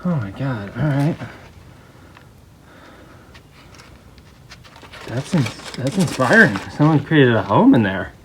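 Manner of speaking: muffled behind a respirator mask; through a respirator. Real, not acted. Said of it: "it's 'cause I have a respirator on".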